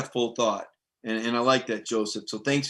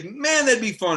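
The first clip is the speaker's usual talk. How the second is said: mock eager fan; 8 semitones higher than usual. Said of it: "I've heard people say".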